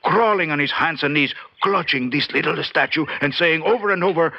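ordinary talk, nothing marked

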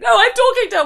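very high, loud squawk; mock shrieking protest. Mimicking a drunk friend fighting for attention, shrill and loud. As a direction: shriek it over the crowd. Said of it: "And it's like".

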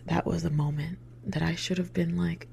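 usual speaking voice

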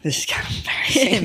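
whispered under the breath, a little sheepish; embarrassed whisper. Real, not acted. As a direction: hush it like you don't want anyone to hear. Shy remark showing embarrassing photo.

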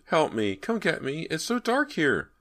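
put-on high child's voice begging for help; frightened child, pitch climbing; pleading little boy. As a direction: plead like a small boy trapped in the dark. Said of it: "the voice pleaded".

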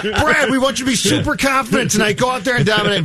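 mock pep talk.

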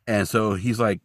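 ordinary talk, nothing marked

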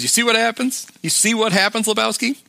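high-pitched retold thug taunt; taunting question in a mock thug's voice; imitated taunt. Quoting the thug's taunting threat.

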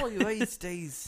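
slow, high Aussie drawl; laconic outback drawl. Acted. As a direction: drag it out like an outback bloke. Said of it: "Yeah, Australian?".

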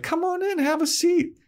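put-on very high breathy-free girlish squeak, far above his own; Willow voice. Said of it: "She's like".

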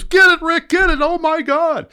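shrieking high and loud, frantic; frantic client. Retold or imitated. Shriek it, way up high. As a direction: client screaming at his guide Rick to get the fish, frantic shriek. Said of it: "So Michael says".

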